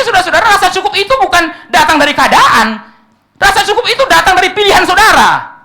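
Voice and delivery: loud, high, fervent pulpit voice; preaching at full force. Acted. Preacher exhorting congregation about contentment, loud and fervent. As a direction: drive each line home to the congregation.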